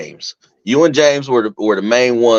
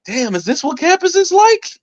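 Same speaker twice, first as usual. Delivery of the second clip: high amazed voice. Retold or imitated. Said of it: "And I'm like".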